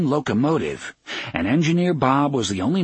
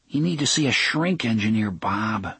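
usual speaking voice

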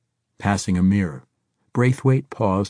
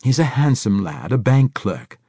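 defiant; 5 semitones higher, 5 dB louder than usual. A narrator's reading. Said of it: "offered with an odd defiance".

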